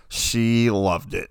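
loud, drawn-out sarcasm; slow, emphatic and sarcastic. Real, not acted. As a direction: stretch it out with mock enthusiasm. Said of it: "that's a little sarcasm".

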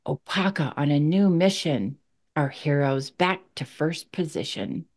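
slow, measured poem reading; poem recital. Acted. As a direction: read it like a heartfelt poem. Reads her poem.

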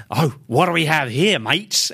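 mock-curious Australian voice; Aussie accent. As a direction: play an Aussie making a discovery. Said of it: "I'm gonna do an Aussie accent".

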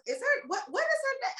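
high, confused asking voice; puzzled high quote. Retold or imitated. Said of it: "Everybody says".

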